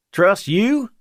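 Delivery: mock doubt, pitched high and rising; lightly joking, high incredulous question; humorous. A narrator's reading. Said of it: "The humor in the lieutenant's voice was good".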